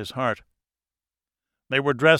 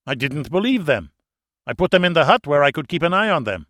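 hurried excuse; 4 semitones higher, 6 dB louder, faster than usual. A narrator's reading. Dieter sly, hurrying to justify.

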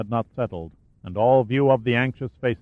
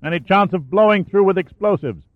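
calling down; 8 semitones higher than usual. Acted. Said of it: "Shaw's voice reverberated down the shaft".

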